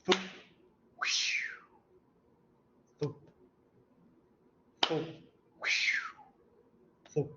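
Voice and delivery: soft thump-whoosh heartbeat sounds; heart sound imitation. Shown as a demonstration. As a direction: mouth the leaky valve heartbeat. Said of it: "it sounds like this".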